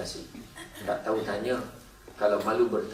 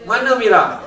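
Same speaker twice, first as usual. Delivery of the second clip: loud call; 9 semitones higher, 9 dB louder, slower than usual. Real, not acted. Searching the audience for Mira.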